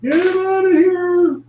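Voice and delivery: high, loud panicked shout; dwarves shouting. Acted. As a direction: bark the order as frightened dwarves. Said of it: "other dwarves look".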